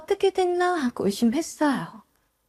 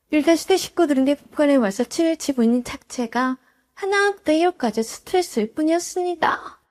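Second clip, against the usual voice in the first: plain reading voice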